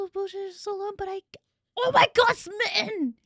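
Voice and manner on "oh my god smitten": loud, high exclamation; excited outburst. Real, not acted. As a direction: blurt it out, overwhelmed with delight. Overwhelmed at shared experience.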